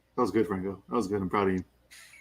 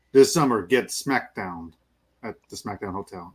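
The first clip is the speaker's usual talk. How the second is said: low, slow, dropping trailer voice; horror trailer voice; 7 semitones lower than usual. Pretend. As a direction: intone it like a horror movie ad. Mock movie tagline, low.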